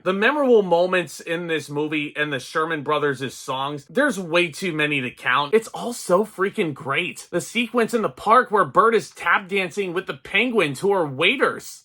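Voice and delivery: ordinary talk, nothing marked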